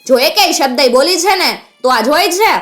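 high-pitched angry warning; flaring threat. A narrator's reading. Outlaw flares up and points his gun, threatening. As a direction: threaten to silence her. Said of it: "મુંગર ભડક્યો".

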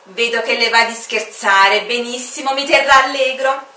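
enjoy the banter openly; cheerful good-humoured stranger; amused Time. Acted.

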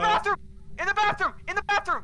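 high, loud urgent shout; calling out. Real, not acted. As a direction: yell the warning to a teammate. Calling out ghost location.

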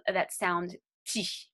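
"ti" drawn out slowly with a crisp T; slow down and model "ti" clearly; the teacher isolates "ti" slowly; slow ti demo. Shown as a demonstration. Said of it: "we can pronounce them that".

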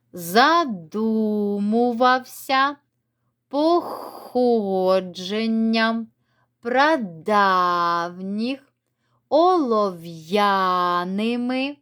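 each word slowly, stress clearly marked; careful stress demo. Shown as a demonstration. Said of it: "давайте я вам допоможу їх прочитати". (повільно, чітко наголошує кожне слово)

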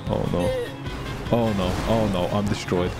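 normal talking voice

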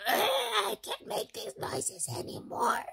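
high, slow whiny wail of defeat; hammed-up tired whine; mock despair. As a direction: play up being worn out, half crying. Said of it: "and I was like".

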